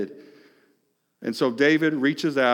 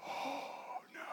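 breathy whisper of realization; whispered dismay; 12 semitones higher, 22 dB quieter than usual. Retold or imitated. David broken open by guilt, whispered dismay. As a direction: breathe it as the truth hits.

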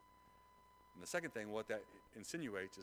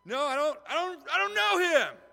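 frantic denial; 20 dB louder than usual. Acted. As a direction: yell it desperately to be rid of the accusers. Acting Peter's frantic last denial.

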